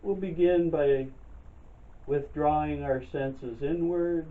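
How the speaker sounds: calm, unhurried meditation guide, long pauses between phrases; guided meditation. Real, not acted. Leads breathing and chair yoga.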